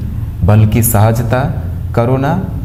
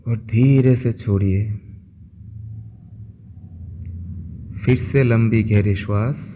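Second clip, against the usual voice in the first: soft, unhurried voice with long pauses; guided meditation; 7 dB quieter than usual. Acted. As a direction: guide listeners gently into stillness, phrase by phrase. (शांत, धीमे स्वर में ध्यान कराते हुए)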